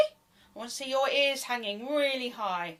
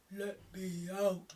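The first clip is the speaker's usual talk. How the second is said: deep bubbly hippo; big slow hippo voice; big hippopotamus voice; 6 semitones lower, 10 dB quieter than usual. Pretend. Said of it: "in a big hippopotamus voice".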